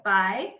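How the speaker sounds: bai said with a rising second tone. Shown as a demonstration. Author showing readers the second tone, rising bai. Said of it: "the second tone where I go".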